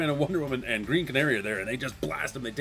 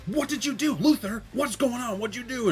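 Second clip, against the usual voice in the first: high-pitched, agitated mock demands; frantic questioning; 10 semitones higher than usual. Heroes demanding answers from Luthor, frantic. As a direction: fire off panicky questions.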